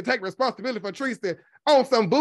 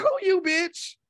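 incredulous retort; 7 semitones higher, slower than usual. Retold or imitated. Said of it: "I'm looking like".

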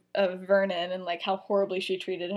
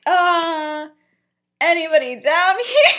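loud, high sing-out; loud mock calling with a laugh; 8 semitones higher, 9 dB louder, slower than usual. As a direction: call into a room to check for company. Acting out calling downstairs.

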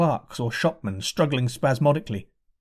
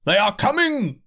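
horseman's warning; 9 semitones higher than usual. A narrator's reading. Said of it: "said a man on horseback".

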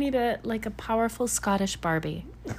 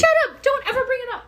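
high mock yell; 14 semitones higher, 4 dB louder, much faster than usual. Real, not acted. Begging co-host not to mention.

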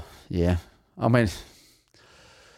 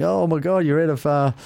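high-pitched mock fan yell; raised, higher voice for a retold shout; imitating screaming fans; 7 semitones higher than usual. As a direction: mimic excited fans hollering at a player. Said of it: "They were screaming at him".

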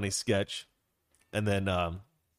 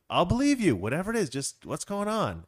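acted pleading, pitched up; insistent reassurance; 6 semitones higher than usual. Friend presses the secretive couple for answers, insistent. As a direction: urge them to just tell you, voice lifted. Voices Jeffrey urging couple to explain.

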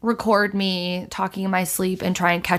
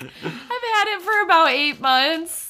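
deadpan mock mom; 6 semitones higher than usual. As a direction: say it deadpan while cracking up. Retelling friend's answer.

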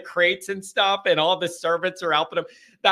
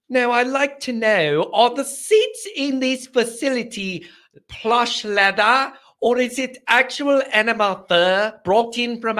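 posh mock accent; 4 semitones higher than usual. Host mocks rich caller with fancy accent. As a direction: ham up an unknown fancy accent. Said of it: "I don't know what accent that is".

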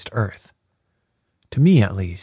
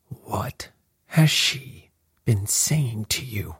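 slow, hushed mock scream, word by word; strained, half-whispered fury, drawn out slowly; furious old man; 2 semitones higher, 5 dB quieter, slower than usual. A narrator's reading. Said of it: "screamed the old man furiously".

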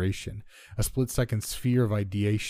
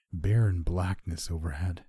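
plain reading voice

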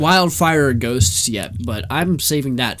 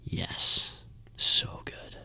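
whispering; 7 dB quieter, much slower than usual. Real, not acted. Whispered praise of the ending.

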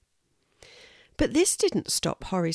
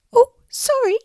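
very high, loud, squeaky-small sorry; a tiny squirrel blurting an apology; apologetic little voice; 10 dB louder than usual. A narrator's reading. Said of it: "said a little voice, very apologetically".

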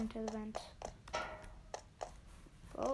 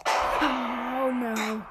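startled; 7 dB louder than usual. Real, not acted. Jump scare in horror game.

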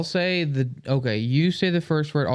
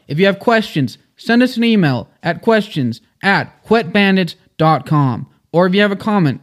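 loud, upbeat outro read; podcast outro announcer; 6 semitones higher, 10 dB louder than usual. Acted. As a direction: sell it like a radio host.

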